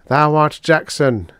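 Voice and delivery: high, intoned solemn declaration; solemn robot. A narrator's reading. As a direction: pronounce it like scripture. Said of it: "said the robot solemnly".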